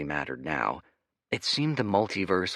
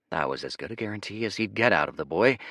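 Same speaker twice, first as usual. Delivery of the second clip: plain reading voice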